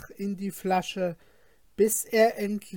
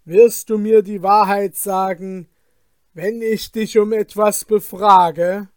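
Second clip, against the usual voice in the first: stern fisherman; 2 semitones higher, 12 dB louder than usual. Acted. Fisherman questions the genie loudly.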